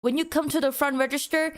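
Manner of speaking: ordinary delivery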